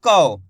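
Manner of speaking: कौ said low and loud with au; model syllable kau. Shown as a demonstration. Teacher models कौ for students. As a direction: drop low and say कौ with an open au. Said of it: "इसको कैसे पढ़ेंगे".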